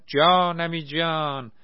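loud, high, drawn-out cheer; joyful shout. A narrator's reading. Naser cheers that Argentina won, loud and high. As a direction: whoop with delight. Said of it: "با خوشحالی فریاد زد".

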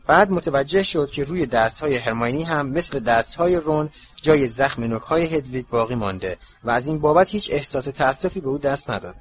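ordinary delivery

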